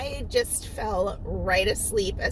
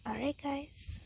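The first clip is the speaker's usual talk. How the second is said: whispering; 4 semitones higher, 11 dB quieter than usual. Real, not acted.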